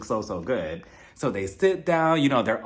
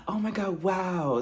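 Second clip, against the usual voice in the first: higher, softer hushed amazement; awed; 5 semitones higher, 3 dB quieter, slower than usual. Retold or imitated. Sergio marvelling at the embryo photo, hushed awe. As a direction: breathe it out in wonder. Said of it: "he's just like".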